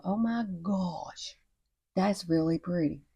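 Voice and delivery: drawn-out, hushed mock amazement; softly marvel, stretching the words out; slow, soft acted awe; awed reaction. Acting out viewer's future awed reaction.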